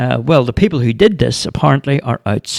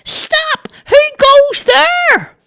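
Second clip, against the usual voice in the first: very high, slow, louder Stop; straining to sound bigger; squeaky high shout; 4 dB louder, much slower than usual. A narrator's reading. Charlie shouts his challenge again, squeaky and louder. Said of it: "Charlie said".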